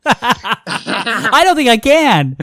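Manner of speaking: laughing through the words. Real, not acted. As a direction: giggle while speaking. Laughing after failed voice.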